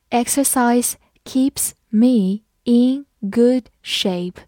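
Exercise keeps me in good shape said slowly, word by word; slow read-along. Shown as a demonstration. Teacher reading slowly so the class can follow. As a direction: read it slowly for students to repeat. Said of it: "跟着我慢读一遍".

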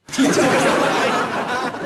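high, breathy burst of laughter; laughing. Real, not acted. Host laughing at his own teasing, real laugh. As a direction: laugh at your own joke.

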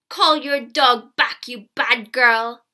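slow, loud angry shout. A narrator's reading. Frightened red-faced peddler to the girl, shouting. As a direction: shout each word hard. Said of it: "he shouted".

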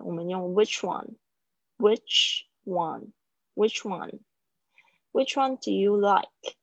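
"one" said slowly, like "wan" with no ng ending; teacher drilling "one" for the class; slow pronunciation demo. Shown as a demonstration. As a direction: model "which one" slowly and clearly, open vowel in "one". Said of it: "注意一下那个one的发音哦".

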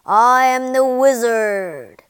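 huge, loud booming giant voice. A narrator's reading. Giant head announcing itself to Dorothy, booming. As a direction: boom it out, long and grand. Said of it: "boomed a giant head".

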